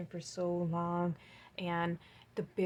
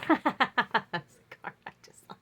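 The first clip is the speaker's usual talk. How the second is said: laughing; 4 semitones higher, 8 dB louder than usual. Real, not acted. Laughing at card coincidence.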